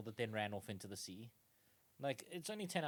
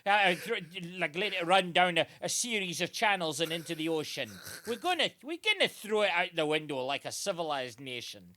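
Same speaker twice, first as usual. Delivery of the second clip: higher, louder ranting father, still half-laughing; outraged Scottish dad; 4 semitones higher, 9 dB louder than usual. Retold or imitated. Dad insisting windows are civilized, loud mock rant. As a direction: shout it like a scandalised Scot. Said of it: "to his dad".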